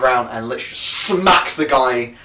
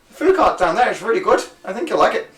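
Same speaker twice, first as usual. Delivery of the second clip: friendly local; 7 semitones higher than usual. Acted. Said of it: "And he goes".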